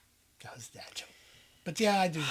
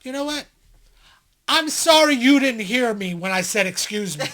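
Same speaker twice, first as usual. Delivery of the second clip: loud, raised-pitch fake sorry; sarcastic apology; 5 semitones higher, 10 dB louder than usual. Train rider answering a demand to apologize, loudly sarcastic. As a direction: hit SORRY hard, not sorry at all.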